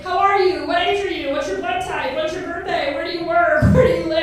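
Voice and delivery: mock interrogation, firing question after question; mimicking strangers grilling her with small talk; nosy small-talker. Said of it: "They're like".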